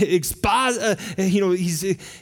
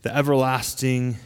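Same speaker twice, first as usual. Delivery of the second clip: prayer; 5 semitones lower than usual. Real, not acted. Pastor opens with prayer.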